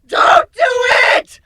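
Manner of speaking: high, loud desperate yell of warning; frantic shout. A narrator's reading. Nightmare bursts in shouting at Griffin to resist. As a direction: scream at him to stop. Tug of war between voices.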